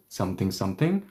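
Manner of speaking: ordinary talk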